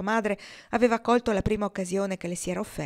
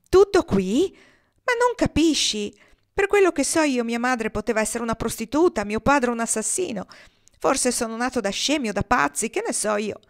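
raised, much higher angry voice, fists clenched; angry outburst; 4 semitones higher, 6 dB louder than usual. Acted. Cry it out in anger, much louder and higher. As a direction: Hiram flares up at Kendra, louder and high-pitched. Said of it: "le strinse a pugno".

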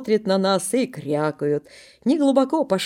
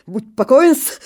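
louder, higher rasp; хрипло; hoarse reassurance; 5 semitones higher, 7 dB louder, slower than usual. Acted. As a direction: wheeze it out stubbornly. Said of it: "хрипит Косой".

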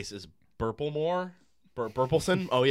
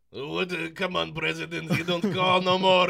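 higher, mock drunken complaint; drunk Russian premier; 6 semitones higher, 6 dB louder than usual. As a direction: slur it like a sulky drunk. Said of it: "they're like".

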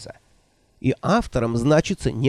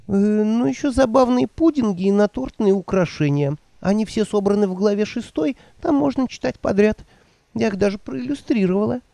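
put-on higher, fluttery old woman voice; 8 semitones higher than usual. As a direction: play the flustered old witch. Nanny voiced by narrator.

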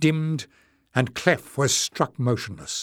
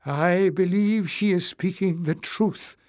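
put-on higher, wavering old voice; quavering; 7 semitones higher than usual. A narrator's reading. Knowles timidly backing the stranger, a high quaver. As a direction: let it tremble as you speak up. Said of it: "quavered Knowles".

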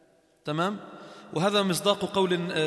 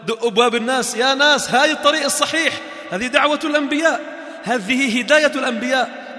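louder, higher call to the people; 5 semitones higher, 5 dB louder than usual. Acted. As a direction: call out to everyone like a preacher in the street. Preacher calling people to the path. (نداء بصوت مرتفع للناس)

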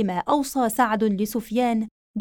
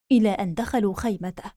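ordinary delivery

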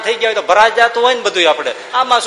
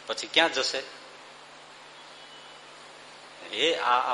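hushed moral warning; 6 semitones lower, 8 dB quieter than usual. Real, not acted. Quiet moral warning after shouting.

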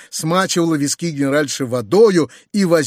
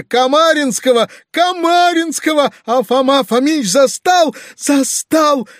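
very high, loud bawling; sobbing wail; 10 semitones higher, 5 dB louder than usual. A narrator's reading. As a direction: bawl every phrase in a shrieking lament. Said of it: "всхлипывая". (громко, с плачем)

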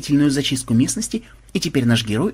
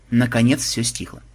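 plain reading voice

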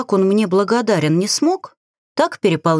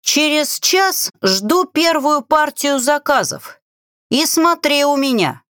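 calling out; 7 semitones higher than usual. A narrator's reading. Woman calls after the departing boat, shouting. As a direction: call after someone in a mild shout. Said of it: "прокричала".

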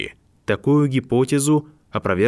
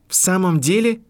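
hissed; 11 semitones higher, 3 dB louder than usual. A narrator's reading. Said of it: "прошипел он".

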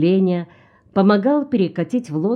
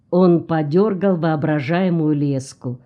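ordinary delivery

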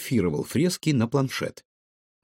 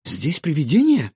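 higher, louder alarmed question; startled girl's anxious query; frightened; 6 semitones higher, 5 dB louder than usual. Acted. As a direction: ask it with sudden fright. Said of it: "испугалась Илона".

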